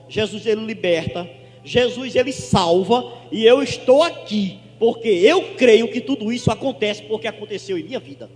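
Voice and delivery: rising to a loud preaching climax, slowing on salva; building preaching. Acted. Pastor testifying to the congregation, building to loud preaching. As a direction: preach it harder and harder to the church.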